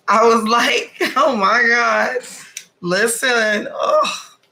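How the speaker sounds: loud, high-pitched gushing; swooning excitement. Retold or imitated. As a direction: gush as if overcome by a crush, drawing it out. Gushing over the handsome stranger.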